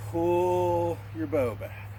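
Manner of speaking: slow, steady instruction; slow drawn-out cue. Shown as a demonstration. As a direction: stretch the words out slowly as the bow draws. Teacher demonstrates slow bow draw.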